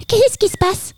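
very high, small mouse voice; waking mouse. A narrator's reading. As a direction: squeak a puzzled question on waking. Sleepy mouse wakes startled.

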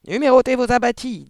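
high, loud, rough squawk; husky parrot threat. A narrator's reading. Hidden parrot menacing the searching family, husky loud squawk. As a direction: squawk it as a hoarse, ghostly warning. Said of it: "voilée et rauque cette fois".

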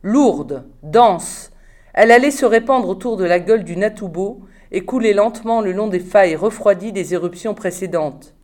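usual speaking voice

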